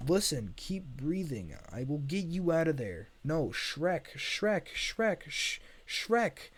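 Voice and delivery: loud, high frantic cries for help; urgent reassurance breaking into repeated yells; panicked calling. Acted. Donkey panics, calls Shrek for help.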